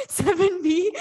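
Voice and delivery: breaking into laughter mid-phrase; real laugh bursting through the words. Real, not acted. Teacher cracking up at the class.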